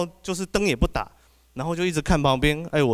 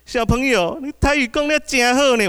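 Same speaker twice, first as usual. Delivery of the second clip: put-on high, louder sugary auntie voice praising a kid; auntie's sweet praise; 11 semitones higher, 5 dB louder than usual. Retelling the auntie on the bus praising the boy, high sweet voice. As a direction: coo it like a charmed auntie. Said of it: "一股声音就说".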